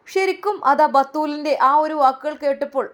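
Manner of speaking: narrating his reaction; story narration. A narrator's reading. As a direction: mark the moment.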